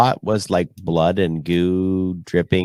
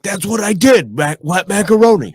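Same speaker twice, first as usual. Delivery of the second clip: much higher, gleeful exclamation; excited Foley artist; 12 semitones higher, 5 dB louder than usual. Acted. Imagined Foley artist claiming their sound effect, excitedly. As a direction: burst out proudly in a high voice. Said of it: "a Foley artist is like".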